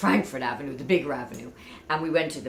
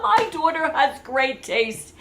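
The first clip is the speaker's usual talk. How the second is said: higher, slower proud line broken by laughter; proud dad, laughing; 9 semitones higher, 4 dB louder, slower than usual. Retold or imitated. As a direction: laugh through a boastful dad's brag. Father's proud reply, laughing.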